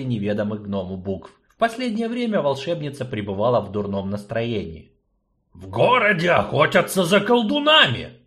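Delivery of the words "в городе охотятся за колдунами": loud urgent gnome report; gnome announcing, loud. Acted. Gnome warns sorceress, loud alarm. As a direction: gnome bursting in with news, louder. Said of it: "сообщил Двалин".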